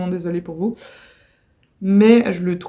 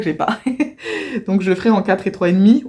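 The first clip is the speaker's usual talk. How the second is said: normal talking voice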